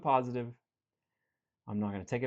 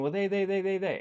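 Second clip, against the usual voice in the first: higher and louder, stuck on "they"; whiny blaming client; 6 semitones higher, 5 dB louder than usual. Retold or imitated. Coach mimicking a client who blames everyone, whiny. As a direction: stammer out "they, they" in a mock complaint.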